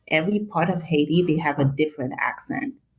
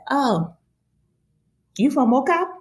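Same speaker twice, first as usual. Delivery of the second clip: higher, curious rising question; mock surprise; 9 semitones higher than usual. People hear her dialect and ask, acting surprised. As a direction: imitate someone surprised to recognize a dialect.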